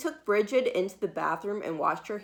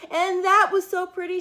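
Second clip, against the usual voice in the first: high, loud sing-song gush; delighted refrain; 10 semitones higher, 6 dB louder than usual. A narrator's reading. Narrator gushing to young listeners, high and delighted. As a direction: gush over how pretty it is.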